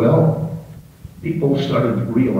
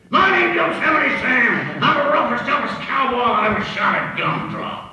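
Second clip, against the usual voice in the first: Yosemite Sam. Acted. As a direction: shout it like a pint-sized rowdy cowboy. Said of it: "So I gave him one that made him noticeable".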